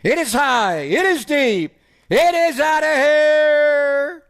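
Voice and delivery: soaring, drawn-out home run call. Pretend. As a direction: build up high and stretch the last line. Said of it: "So you don't have a home run call?".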